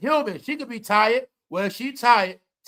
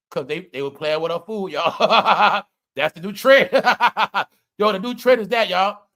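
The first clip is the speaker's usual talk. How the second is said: laughing; 2 semitones lower, 6 dB louder than usual. Real, not acted. Laughing at the ice story.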